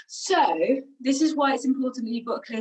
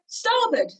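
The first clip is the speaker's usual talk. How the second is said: calling commands; 7 semitones higher than usual. Retold or imitated. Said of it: "And then I'll go".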